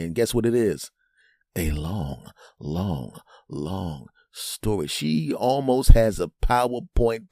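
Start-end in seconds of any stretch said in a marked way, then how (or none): 1.5-4.9 slow, soft, low drawl; drawn-out weariness. Acted. Comic repeating how long the story is, drawn out. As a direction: stretch every long, pausing between them.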